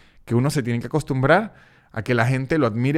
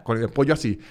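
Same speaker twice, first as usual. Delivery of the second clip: normal talking voice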